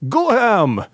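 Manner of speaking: a hint of a Dutch accent, pitched high; lightly imitated Dutch on "Go ham"; mock Dutch. Mimicking the Dutch line from the clip, high and light. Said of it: "whatever in Dutch".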